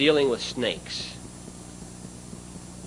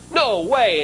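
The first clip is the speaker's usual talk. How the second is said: retold dealer's outburst, pitched higher; a mock-upset salesman refusing flat out; carrying on fierce; 8 semitones higher, 4 dB louder than usual. Salesman refusing the deal, with mock fierce upset. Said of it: "started carrying on something fierce".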